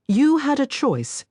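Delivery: slow, high, harsh rebuke. A narrator's reading. Injured stranger harshly rebukes Sarah, slow and high. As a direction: bite the words out slowly, harsh. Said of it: "he said harshly".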